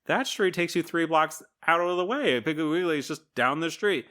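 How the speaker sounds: high-pitched exasperated objection; acted protest. Retold argument over the route.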